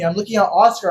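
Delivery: ordinary talk, nothing marked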